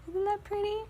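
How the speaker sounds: very high, sing-song coo; cooing pet talk. Real, not acted. Vlogger fussing over her pet, high cooing.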